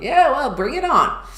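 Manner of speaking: loud, defiant dare; mock tough bravado. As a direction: challenge them to bring it on. Defiant retold self-talk.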